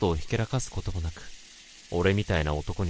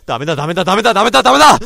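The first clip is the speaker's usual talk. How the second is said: building from loud to a much higher, screaming shout; each no climbs higher and louder until he screams; desperate repeated no; 10 semitones higher, 14 dB louder, much faster than usual. Acted. Fighting off his own evil thought. (だんだん高く大きくなる必死の叫び)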